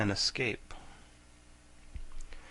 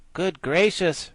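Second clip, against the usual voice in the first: raised, louder exclamation; surprised; 7 semitones higher, 5 dB louder, slower than usual. Acted. The guardian reacts to the girls, mildly surprised. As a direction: caught off guard. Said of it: "returned the surprised guardian".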